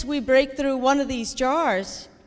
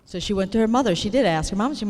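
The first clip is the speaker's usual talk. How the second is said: normal talking voice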